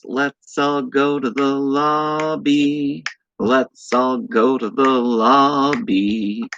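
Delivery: slow, drawn-out eerie chant; creepy sing-song voice. Acted. Streamer reading chat to viewers, in a slow creepy sing-song.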